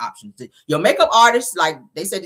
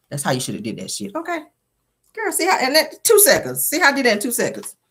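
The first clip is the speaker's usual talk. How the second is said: normal talking voice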